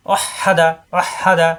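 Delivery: ওয়াহাদা with a long, pressed h in the middle; doubled, held h in ওয়াহাদা; pressed h sound. Shown as a demonstration. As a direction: lean hard on the হা in ওয়াহাদা. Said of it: "হাই তাজ দিয়ে দুইবার".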